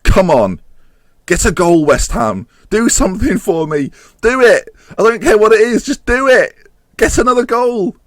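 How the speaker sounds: loud pleading at the screen; will them to score, voice up high; urging the team. Real, not acted. Gamer yelling at his team to score.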